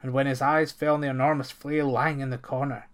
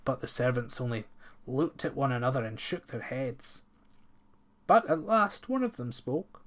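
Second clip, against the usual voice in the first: ordinary delivery, nothing marked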